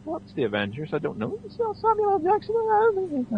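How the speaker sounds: lightly imitated clueless moviegoer, soft; puzzled common man. Common man watching the Avengers tease, mildly puzzled and soft. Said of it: "The common man is going".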